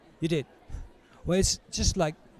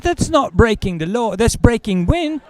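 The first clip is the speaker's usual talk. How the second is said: higher, louder shocked outburst; mock shocked; 8 semitones higher, 5 dB louder than usual. Prisoner reacting to the farting story, mock shocked. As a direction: play it scandalised.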